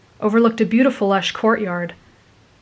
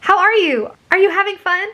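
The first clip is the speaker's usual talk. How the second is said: much higher, perky questions; bubbly girl; 12 semitones higher, 4 dB louder than usual. A narrator's reading. Girl greets her friend at the dance, bubbly. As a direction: chirp it as an eager friend. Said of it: "a bubbly voice".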